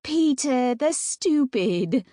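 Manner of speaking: slow, hammed-up mock British; goofy British drawl; silly British accent. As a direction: clown for the little sisters in a British accent. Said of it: "trying to make them laugh again".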